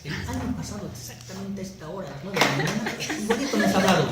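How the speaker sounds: put-on high, loud, laughing jab at a nagging girlfriend; mock scolding girlfriend voice; mock girlfriend complaint. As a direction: play the girlfriend who remembers everything, half laughing. Mocking angry girlfriend's complaint.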